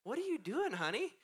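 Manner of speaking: dad finding dresses all over the kitchen; puzzled dad. Retold or imitated. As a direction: gently ask the child. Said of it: "I'm like".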